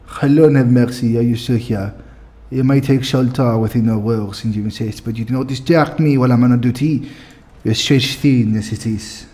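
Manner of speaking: put-on French-accented guard on duty; dismissive elf with a French accent. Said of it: "give them all a French accents".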